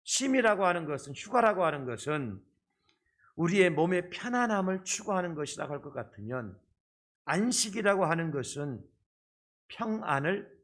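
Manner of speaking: ordinary talk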